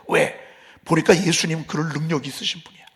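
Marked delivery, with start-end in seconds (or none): none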